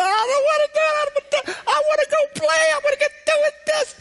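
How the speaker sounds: put-on high, whining kid's complaint; whiny child. Preacher imitating his nephew whining about homework. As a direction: whine like a kid who wants to play. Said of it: "He started crying and said".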